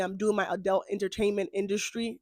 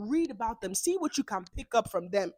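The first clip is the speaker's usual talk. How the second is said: ordinary talk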